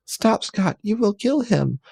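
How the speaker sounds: very high, pleading mock falsetto; high pleading Jean. Jean begs Scott to spare Logan, very high. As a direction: beg him in a hammed-up high voice.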